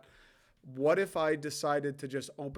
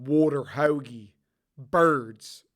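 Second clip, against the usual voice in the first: loud Philly accent catchwords; 9 dB louder, slower than usual. Shown as a demonstration. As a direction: punch the words out in a Philly accent. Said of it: "the water out of the hoagie Philly accent".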